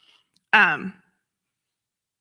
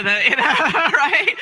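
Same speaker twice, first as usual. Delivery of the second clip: laughing through the words, higher and louder; giggling aside to the congregation; 6 semitones higher, 6 dB louder, slower than usual. Real, not acted. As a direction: breaks into a laugh mid-phrase.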